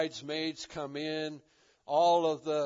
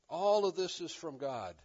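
usual speaking voice

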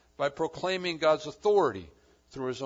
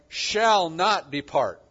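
"shall not depart" very slow and louder, with "shall" hit hardest; emphatic stress; 2 semitones higher, 9 dB louder, much slower than usual. Shown as a demonstration. Preacher driving home the key verse, slow and weighty. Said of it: "the emphatic point".

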